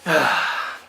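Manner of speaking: heavy, exasperated sigh. Real, not acted. Fan giving up on the card type logic, exasperated sigh. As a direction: let out a frustrated sigh.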